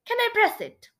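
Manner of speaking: high, eager little request; narrated eager curiosity; curious. A narrator's reading. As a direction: ask hopefully, pitch well up.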